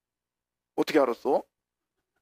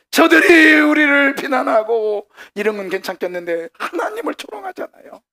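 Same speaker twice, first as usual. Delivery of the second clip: anguished plea; 10 semitones higher, 9 dB louder than usual. Acted. Preacher as exiles complaining to God of mockery, anguished outcry. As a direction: cry out in protest, drop to a high plaintive complaint.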